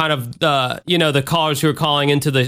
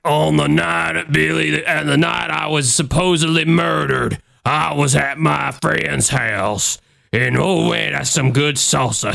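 slow, strained Southern narrator impression; constipated Sling Blade drawl. Podcaster mocking a reenactment's narrator, constipated Sling Blade voice. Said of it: "constipated Sling Blade sounding".

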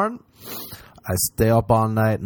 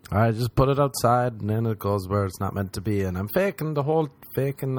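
ordinary talk, nothing marked

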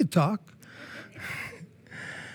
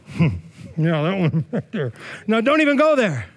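ordinary talk, nothing marked

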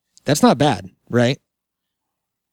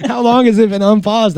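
laughing; 9 semitones higher, 5 dB louder than usual. Real, not acted. Realizes recording was unpaused.

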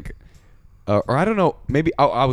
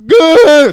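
loud high shriek of "Gah!"; mother's war cry; 13 dB louder than usual. Retold or imitated. Deaf mom kicking the bathroom door in, shrieking. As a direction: charge in screaming. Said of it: "And she's like".

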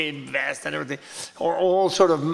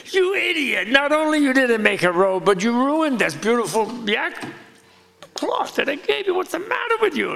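much higher, shrill-pitched furious scolding; angry scolding; 9 semitones higher than usual. Retold or imitated. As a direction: berate him like an outraged sister. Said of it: "and she says".

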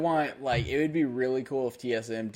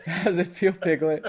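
higher, loud teasing through laughter; laughing taunt; 7 semitones higher, 5 dB louder than usual. Pretend. Host mock-taunting a player beaten by old team, laughing jeer. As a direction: jeer it playfully while cracking up.

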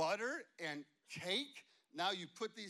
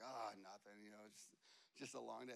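low, soft, downcast mumble; a mild hint of a sulky young man; mopey; 9 semitones lower, 9 dB quieter, faster than usual. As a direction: play it glum and deflated. Matt's mopey reply, retold.

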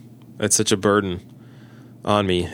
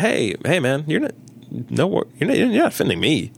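higher-pitched, hasty-to-reassure protest; retold reassurance; 7 semitones higher than usual. Pastor telling an apologetic cab companion no offence taken, retold high-pitched reassurance. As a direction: retell waving off an apology in a raised pitch.

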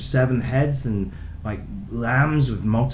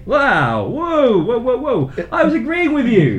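startled protest; 9 semitones higher, 5 dB louder than usual. Real, not acted. Smacked on the arm mid-agreement.

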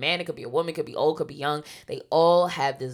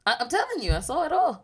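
excited; 11 semitones higher, 4 dB louder than usual. Real, not acted. Excited retelling of trance.

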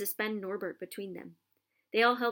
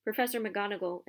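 usual speaking voice

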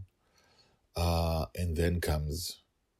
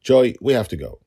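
forced normal tone; 6 semitones higher, 11 dB louder than usual. A narrator's reading. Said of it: "clearly trying to maintain a normal tone of voice".

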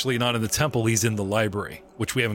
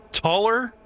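ordinary talk, nothing marked

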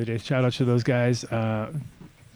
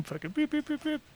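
high, soft imitated reverse beeps; truck beeping; 7 dB quieter than usual. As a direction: chirp like a backing truck.

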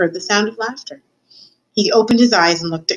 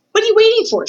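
high, loud, impatient cook; exclaimed in exasperated disbelief; impatient urging; 5 dB louder, faster than usual. Acted. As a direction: pitch it right up and hurry them along. Cook shoos the mouse away.

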